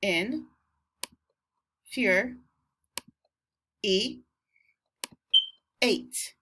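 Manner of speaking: in, fur, e, eight with long pauses between; four isolated syllables: in, fur, e, eight. Shown as a demonstration. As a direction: dictate each piece of infuriate alone. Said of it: "Four syllables, okay?".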